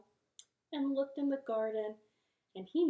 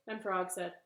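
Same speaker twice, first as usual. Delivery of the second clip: ordinary delivery, nothing marked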